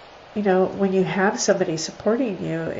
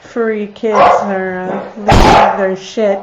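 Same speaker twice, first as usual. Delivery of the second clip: raised voice over dogs; 9 dB louder than usual. Real, not acted. Explains barking dogs to viewers.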